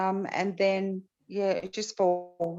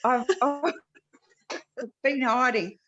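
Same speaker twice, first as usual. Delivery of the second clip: embarrassed surprise; 6 semitones higher, slower than usual. Real, not acted. Caught hiding off camera.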